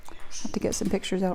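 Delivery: quick whisper. Real, not acted. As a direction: mutter it under your breath, quickly. Whispered aside while busy.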